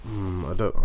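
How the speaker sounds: ordinary talk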